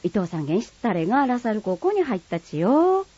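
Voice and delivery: news shared in broad Kagoshima dialect, rising at the end. Acted. Neighbour passing on school news in Kagoshima dialect. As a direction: gossip it in thick Kagoshima dialect. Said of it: "鹿児島弁は".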